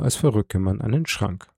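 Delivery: usual speaking voice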